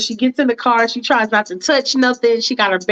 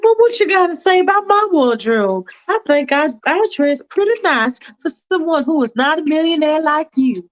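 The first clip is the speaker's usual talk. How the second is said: higher, prim and hesitant; a fussy woman hedging politely; Faith's fussy voice; 8 semitones higher than usual. Acted. Faith hesitating to the driver, prim and higher. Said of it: "she's like".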